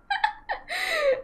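high, breathy mock-evil giggle; a short high cackle, playfully menacing; mock villain laugh. Gamer after threatening her boyfriend Sim, high mock-evil giggle.

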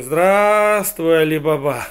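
loud, high, stretched-out greeting; very polite hedgehog. Acted. Hedgehog hails Ali Baba, loudly and over-politely. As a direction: hail him with drawn-out, hammed-up courtesy. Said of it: "жил очень вежливый ёжик".

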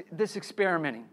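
usual speaking voice

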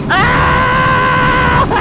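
long, high, loud scream; panicked scream. Real, not acted. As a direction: scream out in terror of the chase. Attacked by boss.

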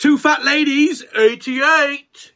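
high, slow sing-song bingo call; bingo caller. Pretend. Joking with his mate about bingo night, hammed-up bingo caller. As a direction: drag out the number like a caller at the hall.

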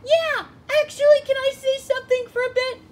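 very high, loud squeaky sidekick; Pikachu. Acted. Sidekick asking daddy for a word, eager squeak. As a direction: pipe up eagerly in a tiny voice. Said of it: "Sure, Pikachu".

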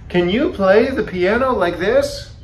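high, boastful question; crying out. A narrator's reading. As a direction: show off to your friend in the audience. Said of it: "cried Toad".

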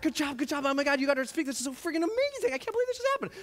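high, gushing voices of well-wishers; excited congratulations. Retold or imitated. Colleagues congratulating the teacher on her speech, excited gushing. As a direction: gush in amazement, pitch climbing.

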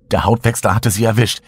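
ordinary delivery

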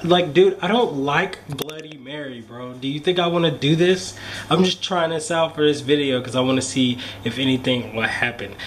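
usual speaking voice

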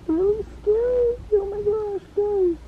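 high, slow frightened whimper; real fear, voice crying out; scared whimper. Real, not acted. Looking at scar, cry heard.

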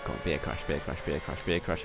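low, very quiet repeated chant; muttered plea. Real, not acted. Gamer hoping for a crash, muttered pleading. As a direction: wish for it under your breath. Wishing for a crash under breath.

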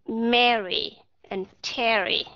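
Mary and Terry said slowly, short E in both; teacher models the short E, slowly; careful model reading. Shown as a demonstration. Teacher to students learning English vowels, slow careful demo. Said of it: "nghe kỹ ha".

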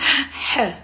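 high sharp inhaled ha; startled ha gasp. Shown as a demonstration. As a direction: gasp in surprise. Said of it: "and it goes like".

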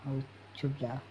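low, very soft repeated warning; hushed, low-pitched urging to take cover. Real, not acted. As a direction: keep it down while hiding. Urging to hide from approaching enemy.

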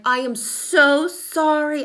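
loud, high, drawn-out mock apology; embarrassed apology. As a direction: re-enact a mortified apology, leaning hard on "so". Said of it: "I was embarrassed".

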